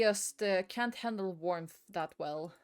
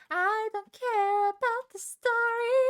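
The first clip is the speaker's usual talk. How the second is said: much higher, slow whiny voice; mock whine; 11 semitones higher, 6 dB louder, slower than usual. Player whining about the game story, hammed-up whine. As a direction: whine it like a bratty kid.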